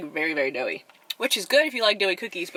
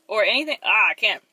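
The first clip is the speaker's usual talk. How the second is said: normal talking voice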